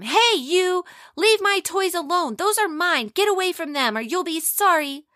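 put-on boy screaming at the neighbor boys; furious screaming. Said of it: "He started screaming at them".

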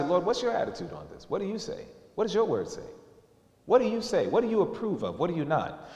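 soft, hushed asking of the Lord; quiet prayer. Acted. Believer quietly asking the Lord about clothing, hushed. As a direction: pray it softly, almost private.

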